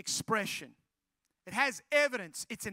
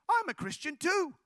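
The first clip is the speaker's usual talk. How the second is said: pitched-up, delighted chime-in; retold girl's eager announcement; excited discovery; 5 semitones higher, 3 dB louder than usual. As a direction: pipe up with happy surprise. Girl delightedly discovers shared faith.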